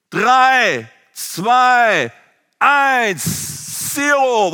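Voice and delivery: counting down the jump, rising tension; countdown. Retold or imitated. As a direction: call out the last numbers.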